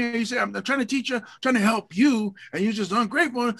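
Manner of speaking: high, exasperated mock scolding; mock mad parent. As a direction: replay your frustration at the daughter, pitched high. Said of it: "and I just get mad".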